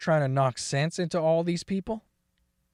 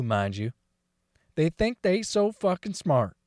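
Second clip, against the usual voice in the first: ordinary talk, nothing marked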